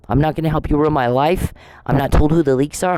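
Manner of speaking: ordinary talk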